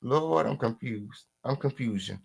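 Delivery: normal talking voice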